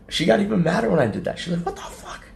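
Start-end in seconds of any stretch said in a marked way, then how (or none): 1.6-2.2 retold outraged whisper. Imitates angrier Kate.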